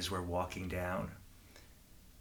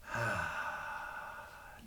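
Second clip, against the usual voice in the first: long, soft breathy exhale; relaxed sigh; 3 semitones lower, 7 dB quieter than usual. Shown as a demonstration. Leader breathing out to relax the children, relaxed sigh.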